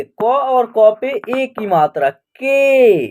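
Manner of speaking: "के" held on its vowel; pronunciation demo. Shown as a demonstration. Teacher building the letter's sound for learners, "के" drawn out. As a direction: spell it out slowly, landing on a long "के". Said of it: "तो इसका उच्चारण करेंगे".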